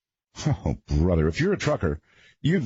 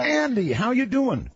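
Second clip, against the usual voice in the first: bright, much higher hello to a caller; pitched-up, eager on-air hello; excited greeting. Real, not acted. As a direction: welcome him in with a big lift. Host greets a live caller.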